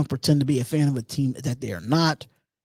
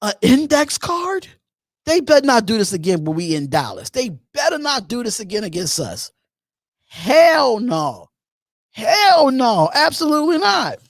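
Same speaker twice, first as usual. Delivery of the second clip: retold indignation; 6 semitones higher than usual. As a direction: relive your livid disbelief, pitch jumping up. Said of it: "I was like".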